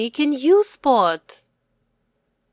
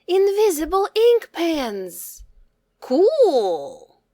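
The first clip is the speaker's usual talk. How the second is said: high, thrilled "ink" in "Invisible ink pens!"; excited cry; 7 semitones higher, slower than usual. A narrator's reading. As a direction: gasp it out like kids who just got a gift. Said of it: "cried the boys together".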